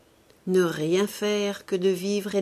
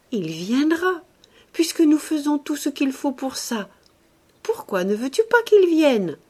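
higher, louder, eager lover's plea; playful loving boldness; 8 semitones higher, 6 dB louder than usual. A narrator's reading. Woman in love urging him about a child, boldly. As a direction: coax boldly, pitch lifted, pressing the question. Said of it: "avec une jolie audace d'amoureuse".

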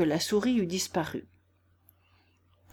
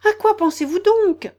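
high, loud indignant question; storybook mouse demanding attention; severe scolding; 11 semitones higher, 9 dB louder than usual. A narrator's reading. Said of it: "d'un air sévère".

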